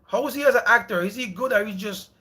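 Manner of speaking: normal talking voice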